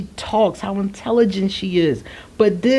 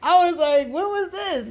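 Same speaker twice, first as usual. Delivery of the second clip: laughing disbelief; 10 semitones higher, 3 dB quieter than usual. Retold or imitated.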